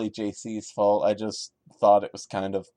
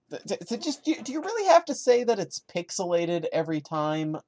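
exasperated; 8 semitones higher, faster than usual. Real, not acted. Ranting at the story's writer.

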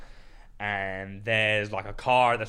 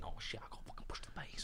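whispered thought; 21 dB quieter, faster than usual. Retold or imitated. Inner thought while training.

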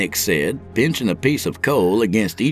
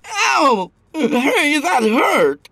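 high whiny yelp; bleating; 3 dB louder, much slower than usual. A narrator's reading. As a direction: yelp like a wounded coward. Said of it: "he bleated".

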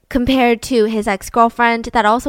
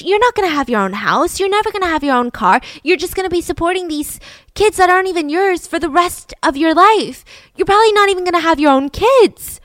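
pissed-off parents; 7 semitones higher than usual. Retold or imitated. Pissed parents to their daughter about her older boyfriend, angry scolding. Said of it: "the parents are beyond pissed".

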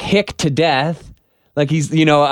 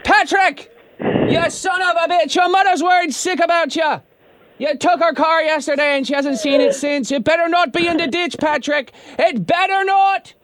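high Irish yell across the pub; 11 semitones higher than usual. Pretend. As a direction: bellow his name, Irish accent. Yelling for brother on speakerphone.

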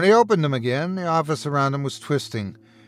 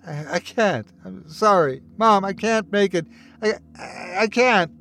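halting, higher, distressed stammer; broken pleading, barely getting it out; squeezed-out, choked words; 6 semitones higher than usual. A narrator's reading. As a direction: choke the words out between gags. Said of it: "managed to squeeze out a few words".

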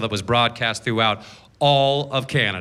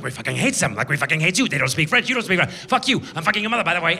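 French Canadian heckler; 3 semitones higher, much faster than usual. Retold or imitated. French Canadian crowd rants back.

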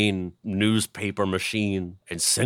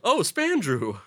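much higher, sing-song greeting; mock greeting; 10 semitones higher, slower than usual. Friend spotting him in a park, much higher greeting. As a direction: greet him in a high cheery voice. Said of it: "you were like".

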